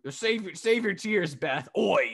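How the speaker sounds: slow, high mock British jeer; cheeky British commentator impression; British accent. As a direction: imitate Wade's drawn-out British "oi". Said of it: "Wade going".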